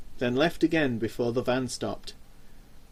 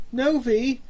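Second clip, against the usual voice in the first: frightened stammer; 10 semitones higher than usual. Acted. Terrified James stammers again.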